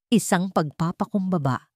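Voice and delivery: ordinary delivery, nothing marked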